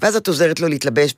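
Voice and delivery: ordinary talk, nothing marked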